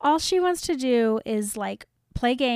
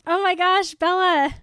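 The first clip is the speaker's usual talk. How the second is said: shocked exclamation; 7 semitones higher, 5 dB louder than usual. Real, not acted. Reacting to Bella's grim calm.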